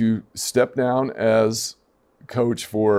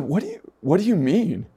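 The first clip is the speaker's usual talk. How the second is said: higher-pitched mock bewilderment; retold incredulity; 6 semitones higher than usual. Coach answering a neighbor's money question, incredulous. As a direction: ask it as baffled disbelief. Retells own amused disbelief at question.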